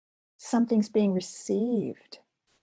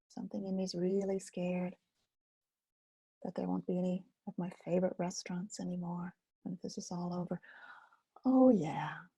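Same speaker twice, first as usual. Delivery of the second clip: trailing into a hush; name the fear gently, almost whispered at the end; soft self-talk demo; 7 dB quieter than usual. Shown as a demonstration. Teacher modeling self-talk to an anxious class, fading to a hush. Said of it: "the same language I'm talking about".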